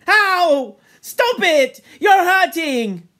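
high, loud yelps of protest; pained cries. Acted. Robert jabbed by the boys, crying out in pain. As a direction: cry out as the jabs hurt. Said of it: "and then in real pain".

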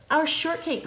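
high-pitched wail of dismay; dismayed cry. A narrator's reading. Iguana seeing the shortcake eaten, dismayed cry. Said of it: "cried Iguana".

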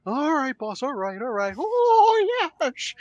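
high-pitched yes-man toady; Bruce Prichard. Acted. Toady agreeing with his boss, high fawning voice. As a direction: fawn eagerly in a high voice, swooping up on "oh". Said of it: "Bruce Picture, come over here and agree with me".

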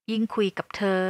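usual speaking voice

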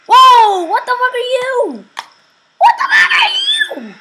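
high, loud startled yelling; startled shouting. Real, not acted. Startled by something appearing in game.

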